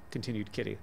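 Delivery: ordinary delivery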